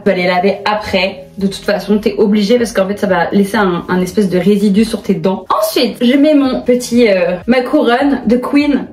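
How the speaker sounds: usual speaking voice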